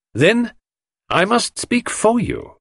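very high, slow, measured declaration; Poirot, resolved. Acted. Poirot decides to speak for the suspect, resolutely. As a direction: announce it with firm resolve.

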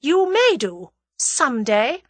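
veiled threat, starting high; meaningful, ominous reply; significant. A narrator's reading. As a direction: say it pointedly, loaded with menace. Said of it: "said the other significantly".

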